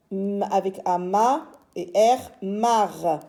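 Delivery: teacher builds "mar" sound by sound; sounding out letters. Shown as a demonstration. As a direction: hum a long "m", add "A" to make "ma". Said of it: "on va d'abord lire les syllabes".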